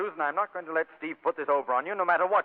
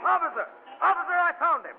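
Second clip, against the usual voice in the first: urgent, high-pitched call; raised voice, alerting the police; 9 semitones higher than usual. Acted. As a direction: hail the officer excitedly. Calls out to police officer.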